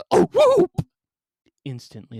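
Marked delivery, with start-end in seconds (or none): 0.1-0.9 loud, very high whoop cry. Acted. Boy on the ramp whoops loudly, high and sharp. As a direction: let out a shrill whoop. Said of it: "He breathed and coughed".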